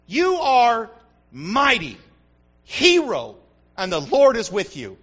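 God urging Gideon; God calling out. Acted. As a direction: call him out like God. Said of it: "God says".

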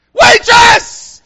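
full-voiced, very high desperate scream; crying out. Diner crying out to the waitress for water, screamed plea. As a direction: bellow a mock-desperate plea across the room. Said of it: "just cry out like blind Bartimaeus did".

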